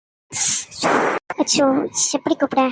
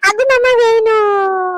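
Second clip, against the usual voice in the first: put-on higher, very slow crying little boy; whiny child; 6 semitones higher, 3 dB louder, much slower than usual. Little grandson refuses medicine, whining for his uncle. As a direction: whimper it like a sulking toddler. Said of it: "Raghu மாமா வேணும்னு அழுதுகிட்டு".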